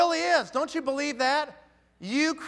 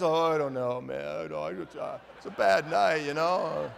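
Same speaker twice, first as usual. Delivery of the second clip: deflated mumble; 7 semitones lower than usual. Retold or imitated. Recruit the next day, deflated and mumbling excuses. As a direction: sound deflated and noncommittal.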